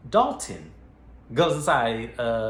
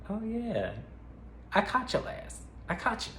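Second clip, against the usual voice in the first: gloating; 11 semitones higher, 3 dB quieter than usual. Retold or imitated. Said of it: "And it was like".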